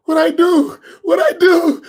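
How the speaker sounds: high, loud, frantic repeated question; panicked host. Retold or imitated. Host panicking that he upset the sheikh, high frantic question. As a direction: blurt it out in sudden panic. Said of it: "the guy was looking like".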